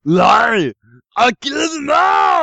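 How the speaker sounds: frantic shouting hostage taker. Acted. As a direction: shout it, high, slow and wild. Shouted Schwarzenegger movie quote.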